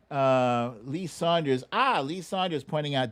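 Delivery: usual speaking voice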